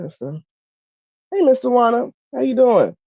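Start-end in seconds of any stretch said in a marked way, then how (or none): 1.3-3.0 high, lively hello; calling out a greeting. Real, not acted. Streamer greets Mister Warner, calling out. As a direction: call a friendly hello to a neighbor. Calls out greeting to someone passing.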